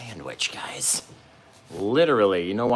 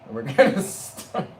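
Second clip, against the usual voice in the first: laughing; 7 semitones higher, 6 dB louder, slower than usual. Real, not acted. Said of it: "I can't even keep a straight face".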